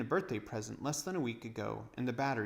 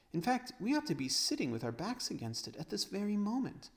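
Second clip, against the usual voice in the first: put-on girlish voice; 9 semitones higher than usual. Lucy voiced.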